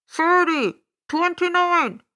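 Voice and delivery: loud countdown over a PA; booming loudspeaker. Acted. Loudspeaker counting down to the broadcast, booming announcer voice. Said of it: "A loudspeaker voice boomed".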